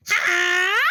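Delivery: high, open "ah" yell; sudden high yell, held; loud shout. A narrator's reading. Emu pouncing on fleeing Nene, loud shout. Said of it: "shouts Emu".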